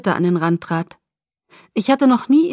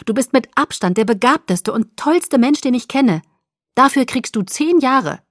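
higher, gushing with delight; thrilled, raised-pitch admiration; excited praise; 5 semitones higher, 4 dB louder than usual. Acted.

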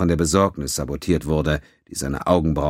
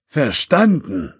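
curt; 9 semitones higher, 5 dB louder, much slower than usual. A narrator's reading. Judge curtly agreeing.

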